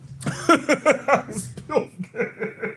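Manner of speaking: high helpless laugh; burst of laughter. Real, not acted. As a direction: crack up at yourself. Splashed water on face.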